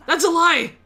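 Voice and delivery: furious shout, high and loud. Acted. Kei yelling at Edena that she's lying, furious shout. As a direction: yell the accusation at her. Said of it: "My shout seemed to scare her".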